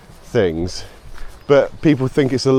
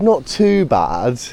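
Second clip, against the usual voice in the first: slow, drawn-out shrug of a reply; British understatement; much slower than usual. Retold or imitated. Brit answering how he is, slow and deadpan. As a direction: undersell it, slowly and wearily. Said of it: "Such a funny phrase".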